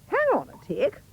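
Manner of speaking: high, brisk fairy voice. A narrator's reading. Fairy tells Cindy to wait, high and brisk. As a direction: chirp it like a busy fairy. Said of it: "The fairy said".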